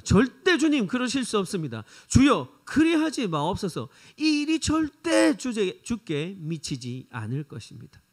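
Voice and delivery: cling to him and refuse; desperate protest. Acted. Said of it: "베드로가 예수님을 붙들고 이렇게 얘기했습니다".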